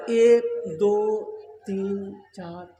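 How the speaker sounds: very slow count, sinking away softly. Retold or imitated. Villager counts the storeys of a Calcutta tower, slow counting. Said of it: "एक आदमी गिनती करने लगा".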